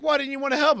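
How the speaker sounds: high, loud scolding question; stepmom scolding. Retold or imitated. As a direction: demand it like a cross stepmom. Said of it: "She's like".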